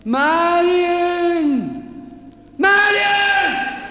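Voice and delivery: high, loud call for Marion. Acted. Dying mayor calls his housekeeper, high loud shout. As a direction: bellow her name from the bed.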